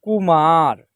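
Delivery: कुमार loud, short कु and long मा; drawn-out model reading. Shown as a demonstration. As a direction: hold the आ in कुमार. Teacher models the blended word.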